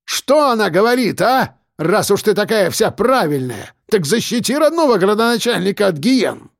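high, strained yelling voice; mayor sneers at the righteous journalist, high and shouting. Acted. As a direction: hurl mocking scorn at her. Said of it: "Он кричал уже почти в упор".

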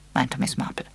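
plain reading voice

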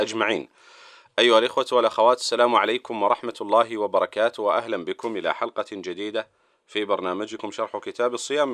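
formal radio host opening; formal standard arabic. Acted. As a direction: welcome listeners like a broadcast host. Radio host opens the programme.

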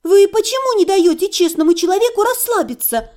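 возмущённо, выше; indignant. A narrator's reading. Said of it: "возмутилась я".

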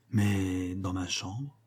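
slow, low and hushed; hesitant. Acted. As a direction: draw it out softly, reluctant to say it. Embarrassed answer about the meeting place. (hésitant, lentement, tout bas)

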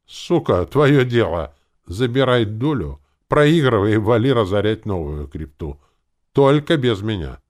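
loud, high angry tirade. A narrator's reading. Leader washing his hands of gambler, angry. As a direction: keep hammering it out, heated. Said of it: "продолжил на повышенных тонах".

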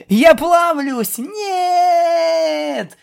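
high, drawn-out mock death scream; melodramatic wail. Tickled pegasus playing a dying monster, long wailing scream. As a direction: wail as if melting away.

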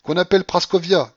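high, loud imperious order; commanding. A narrator's reading. Babouchka to Marfa, commanding. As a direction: summon her at full voice. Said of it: "commanda la babouchka à Marfa".

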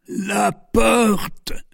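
high, faint, drawn-out call; strained, failing high voice; dying voice. A narrator's reading. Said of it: "said the Duke in a dying voice".

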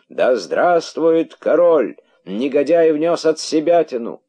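high, loud acclaim turning to accusation; crowd shouting. A narrator's reading. Rabbits hailing the king, blaming the herald, shouting. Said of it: "закричали кролики с удвоенной энергией".

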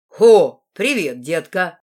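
loud burst, then slow swagger; put-on cheerfulness. A narrator's reading. Red-haired boy greets the new girl, put-on cheerfulness. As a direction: hail with fake joviality, drawn out. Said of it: "с напускной весёлостью сказал рыжий парень".

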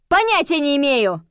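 high, loud exasperated retort; heated shouted answer; yelling. A narrator's reading. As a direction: yell back in frustration. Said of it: "то я уже орала".

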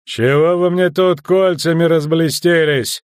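loud, high, scornful yell; drawn-out officer's outburst; indignant shout. A narrator's reading. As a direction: shout down a show of authority. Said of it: "выкрикнул десятник".

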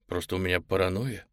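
ordinary delivery, nothing marked

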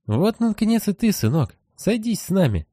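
high hollow ghostly father voice; high, uncanny family voice; eerie parent voice. Acted. Dead family greets son.

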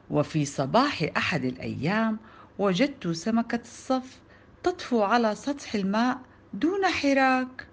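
ordinary delivery

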